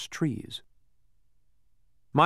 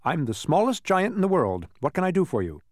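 higher-pitched, self-assured character voice; proud little giant; 7 semitones higher than usual. Acted. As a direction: introduce yourself grandly in a raised pitch. Small giant introduces himself.

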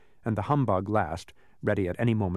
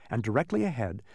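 plain reading voice

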